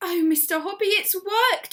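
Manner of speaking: high, loud delighted exclaim; joyful cry. A narrator's reading. Missus Silver calling up about her tortoise, high and loud. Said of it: "she was crying".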